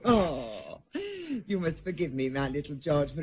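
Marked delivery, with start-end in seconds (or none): 0.0-0.8 pained moan. Acted. Pained moan over migraine.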